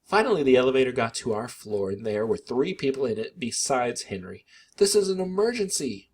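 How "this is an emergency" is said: higher, a touch of a wail. A narrator's reading. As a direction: plead with the elevator riders. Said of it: "Mom wailed".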